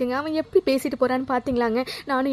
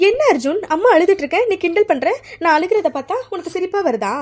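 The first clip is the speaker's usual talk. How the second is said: hurt scolding; 6 semitones higher, 5 dB louder than usual. Acted. Crying mother scolds teasing son.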